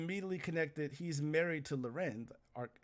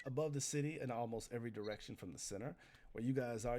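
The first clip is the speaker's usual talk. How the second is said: usual speaking voice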